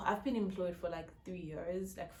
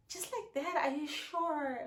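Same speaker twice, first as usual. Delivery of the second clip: disbelieving; 8 semitones higher, 4 dB louder than usual. Retold or imitated. Disbelieving reaction retold.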